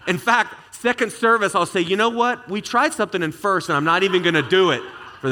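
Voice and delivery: high, loud, giggly aside; embarrassed laughing. Real, not acted. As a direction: laugh it off, a little sheepish. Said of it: "first and last time Pastor [name]".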